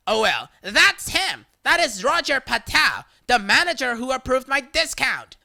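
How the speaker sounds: loud accusing yell. Retold or imitated. Customer yelling at the cashier to accuse him, loud. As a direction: shout and point him out. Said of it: "and yelled".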